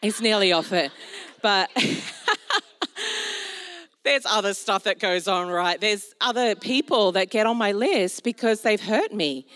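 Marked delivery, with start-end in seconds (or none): 1.7-3.8 breaks into a high laugh; high burst of laughter. Real, not acted. Preacher laughing at her own admission, high laugh.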